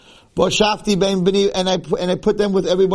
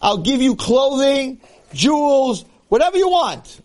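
pleading father; 9 semitones higher, slower than usual. Acted. Father pleading with his son.

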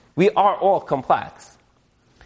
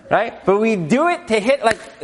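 normal talking voice

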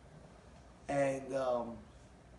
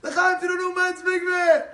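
high, drawn-out shout; slow, celebratory holler; rousing toast; 11 semitones higher, 9 dB louder than usual. Retold or imitated. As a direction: raise a loud toast over the whole crowd. Toast at the Kiddush.

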